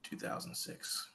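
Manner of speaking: very soft, slow whisper; whispering to oneself. Real, not acted. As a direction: think aloud under the breath. Thinking over the clue to self.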